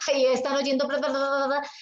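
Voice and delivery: ordinary delivery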